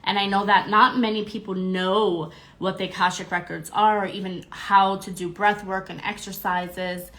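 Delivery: ordinary talk, nothing marked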